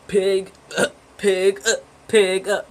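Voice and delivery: high, nasal-free mock oink chant at the cops; mock pig oinking. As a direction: grunt "Pig, uh" like a pig to mock the police. Taunting cops with donut boxes.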